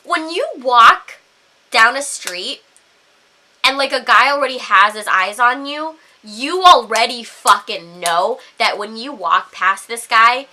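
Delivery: ordinary delivery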